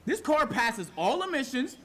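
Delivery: venting to chat about being told his car belongs on the track, angry; furious rant. Real, not acted. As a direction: tear into the cop's remark. Furious about cop's comment.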